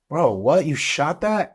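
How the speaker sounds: high, loud disbelief; astonished guide. Retold or imitated. Hunting guide stunned by the girl's shot, high and loud.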